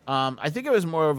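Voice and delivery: ordinary talk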